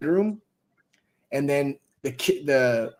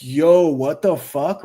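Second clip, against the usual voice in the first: slow stunned disbelief; 2 semitones higher, 4 dB louder, much slower than usual. Retold or imitated. So I'm like.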